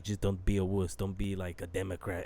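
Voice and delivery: a hint of the stern doctor, pitched low; lightly voiced doctor. Doctor scolding the swabbed patient, lightly imitated low voice. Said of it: "He's like".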